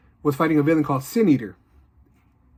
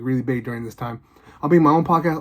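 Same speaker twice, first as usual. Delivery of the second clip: ordinary talk, nothing marked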